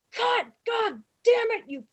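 high, loud furious yelling; screaming tirade. Retold or imitated. Director screaming at extras who got it wrong, furious. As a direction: yell it, berating the whole crowd. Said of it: "Lloyd starts screaming".

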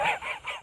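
high wheezing snicker like a cartoon dog; Muttley wheeze laugh. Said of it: "now you're doing it".

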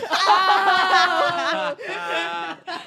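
high, loud, long laugh; big delighted cackle of laughter; loud burst of laughter. Real, not acted. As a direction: crack up at the punchline. Group cracks up at joke.

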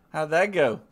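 ordinary delivery

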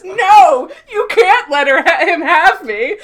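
high, shouted, hammed-up begging; mock panicked plea. [name] pretends to beg Oksana for his life, hammy panic. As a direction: wail it at full volume in fake terror. Acting [name]'s fake plea.

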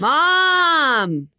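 high, drawn-out holler; calling for Mom. Acted. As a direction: yell across the house for your mother. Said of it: "screaming for whoever was coming".

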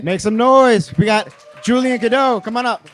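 high, loud crowd hype; hype shout. Real, not acted. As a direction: call out to the crowd like an MC. Hyping crowd, announcing guest.

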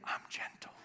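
soft unvoiced whisper; a tender hush from within. Shown as a demonstration. Preacher whispering God's gentle word. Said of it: "He said".

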